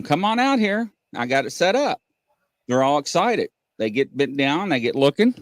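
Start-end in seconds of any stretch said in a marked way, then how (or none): 0.0-2.0 high-pitched call to come outside; retold holler. Father calling his daughters out to the telescope, retold holler. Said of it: "I holler at 'em".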